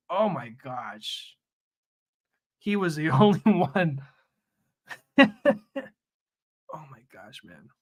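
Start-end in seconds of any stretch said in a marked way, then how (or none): 5.2-5.8 high, louder burst of laughter; quick high real laugh; short laugh. Real, not acted. Amused by own remark.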